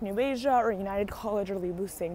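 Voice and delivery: usual speaking voice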